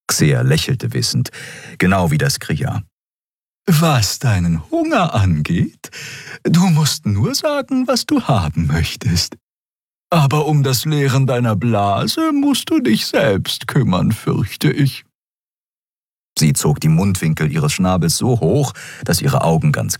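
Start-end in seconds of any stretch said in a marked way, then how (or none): 3.7-15.1 Xia voice. A narrator's reading.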